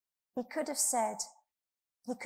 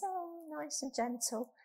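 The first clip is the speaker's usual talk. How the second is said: mock soft; 8 semitones higher than usual. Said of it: "you can think gentleness".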